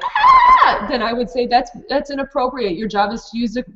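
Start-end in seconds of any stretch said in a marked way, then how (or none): 0.0-0.8 high mock scream of a child, louder; imitated child scream. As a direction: let out a short high kid's "Ah!".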